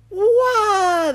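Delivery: long, high, loud wail of fright; fisherman's shrieking "waah"; terrified yell. A narrator's reading. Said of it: "the fisherman yelled".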